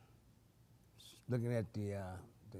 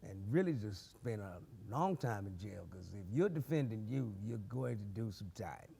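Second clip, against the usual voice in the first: ordinary delivery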